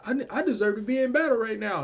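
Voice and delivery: high, loud acted protest; eager soldier. Soldier pleading to be sent into battle, eagerly insistent. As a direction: insist like an untested recruit. Voices an overeager young soldier.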